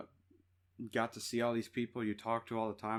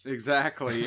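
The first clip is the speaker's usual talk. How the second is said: usual speaking voice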